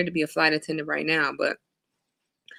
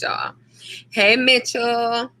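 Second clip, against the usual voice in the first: normal talking voice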